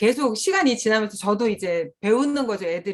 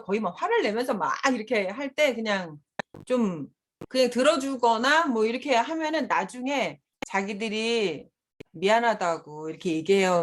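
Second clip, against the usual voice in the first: ordinary talk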